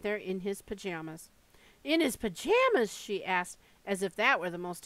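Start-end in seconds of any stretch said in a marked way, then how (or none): 1.8-2.9 aghast question. A narrator's reading. Grandmother aghast at the news.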